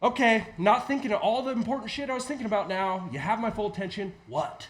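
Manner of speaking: loud, high, fed-up reply that settles into a flat what; worn-down dad finally turning to the kid; exasperated parent. Retold or imitated. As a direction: give in to the nagging kid, irritated. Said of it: "until you're finally like".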